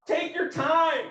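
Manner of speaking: high, stretched-out comic reply; relaxed, drawn out. Retold or imitated. Passenger enjoying the free soda tells driver, relaxed and drawn out. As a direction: draw it out in a high, carefree voice.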